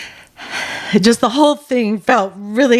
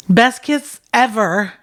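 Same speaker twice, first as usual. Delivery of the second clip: ordinary delivery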